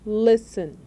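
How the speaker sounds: "Listen" punched on the first syllable, LIS; stress on first syllable. Shown as a demonstration. Teacher showing learners the tonic syllable, "Listen" stressed on LIS. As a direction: say "Listen" strong on LIS, light on the end. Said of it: "A sílaba tônica é a primeira".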